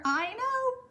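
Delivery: a high delighted burst of longing; gushing agreement. Real, not acted. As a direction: squeal it out fondly. Agreeing she wants to hug granddaughter.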